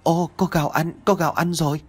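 high, delighted cheer; a hungry child overjoyed. Acted. Little girl seeing the rice sack, joyful cheer. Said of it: "reo lên".

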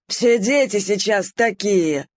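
lightly voiced old poodle grumbling slowly; rueful grumble. A narrator's reading. Old poodle Bob lamenting about youth, slow grumble. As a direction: grumble it like a tired old dog. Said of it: "сокрушенно проворчал пудель Боб".

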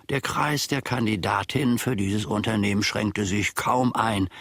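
ordinary talk, nothing marked

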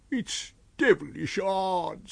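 high, slow moan of a tramp; complaining tramp. Acted. Tramp marching under unseen hands, high slow complaint. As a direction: drag out the grievance in a high voice.

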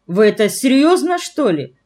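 high, wide-eyed disbelieving question; childishly naive. A narrator's reading. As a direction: ask with childlike wonder, pitch jumping up. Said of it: "по-детски наивно спрашивал Фома". (по-детски наивно)

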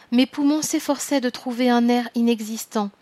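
plain reading voice

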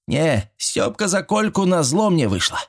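preening, high-pitched declaration; proud boast. A narrator's reading. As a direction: announce it proudly, voice lifted. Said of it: "горделиво приосанившись".

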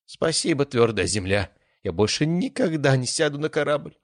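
high, shaky relieved thanks; relieved groan. A narrator's reading. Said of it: "простонал он".

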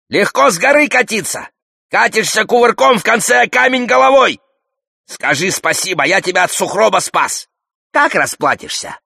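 high, loud haranguing; verbal attack. A narrator's reading. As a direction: berate him at shouting pitch, line after line. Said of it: "Алёш атакует Зухура". (напористо и громко отчитывает)

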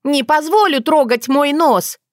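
high, indignant squawk; outraged. A narrator's reading. Dunya refusing to have her nose spoiled, outraged. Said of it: "У разгневанной Дуни".

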